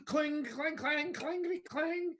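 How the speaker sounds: high-pitched mocking clangs; shrieky mock clatter of pans; imitated pot clanging. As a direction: bang out pot noises with the voice, way up high. Imitating banged pots and pans.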